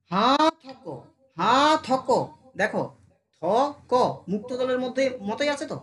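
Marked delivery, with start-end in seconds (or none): 0.1-2.1 "হা" stretched long before "থক"; drawn-out syllable. Shown as a demonstration. Teacher splitting the word for students, "হা" drawn out. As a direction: hold "হা", then add a short "থক". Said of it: "টানছি এখানে".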